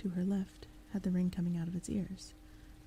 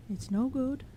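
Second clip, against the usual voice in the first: matter-of-fact; 6 semitones higher, 4 dB louder, slower than usual. Acted. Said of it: "in a matter-of-fact voice".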